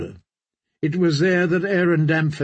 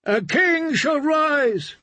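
high, distant cry of the drowned men; crowd cry; 12 semitones higher, slower than usual. Acted. Drowned men crying back to their priest, high. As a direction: call it out as the faithful. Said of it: "the drowned men cried".

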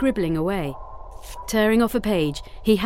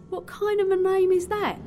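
much higher, baffled question; high bewildered seaman's voice; confused, half asleep; 13 semitones higher than usual. A narrator's reading. Sleepy seaman puzzled by a girl's name, confused. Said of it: "asked Dennis, still half asleep and confused".

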